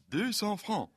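lightly voiced streetwalker quoting her price; a hint of a French lady; French lady's price. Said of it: "zei de vrouw".

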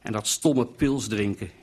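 plain reading voice

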